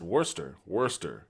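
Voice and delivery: Worcester said as WUSS-ter; Worcester, two short syllables, wuss-ter. Shown as a demonstration. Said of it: "that's how you pronounce it".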